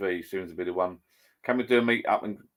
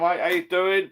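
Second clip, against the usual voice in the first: ordinary delivery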